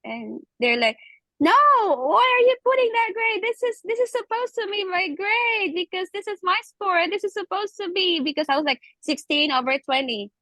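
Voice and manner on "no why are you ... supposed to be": protesting student. Retold or imitated. Student arguing with teacher over a score, indignant protest. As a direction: imitate a student disputing a grade.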